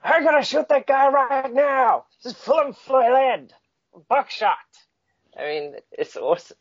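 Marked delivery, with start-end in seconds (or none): 0.0-4.6 much higher, loud, slow yell; howling mock battle cry; shouting soldier. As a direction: bellow like a wild gunner.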